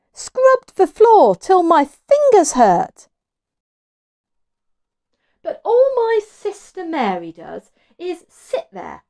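loud, high, slow grumbling; moaning Martha. A narrator's reading. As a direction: complain loudly about the work. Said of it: "moaned Martha".

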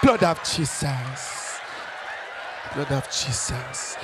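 drawn-out, fading Ghanaian invocation; Kwesi from Ghana. Retold or imitated. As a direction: wind down solemnly in a Ghanaian accent. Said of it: "someone from Ghana pray".

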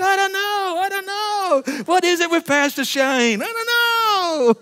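high, slow, wailing I don't know; fake-weepy shrug; mock crying whine. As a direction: ham up a helpless sob.

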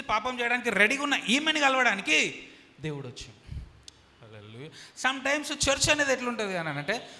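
loud, high, driving sermon voice; preaching at full force. Acted. Pastor preaching hard to his church, loud and high. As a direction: thunder the message at the congregation.

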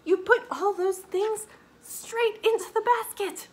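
high, loud, amazed mother; delighted praise. A narrator's reading. Mama praising baby for filling basket, delighted. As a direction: gush with proud amazement.

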